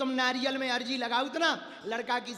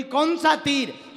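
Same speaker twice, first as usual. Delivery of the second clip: loud, drawn-out, higher-pitched question; 5 semitones higher, 10 dB louder, slower than usual. Acted. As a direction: demand it slowly and loudly. Sita's acted question to Hanuman. (ज़ोर से, खींचकर पूछा गया सवाल)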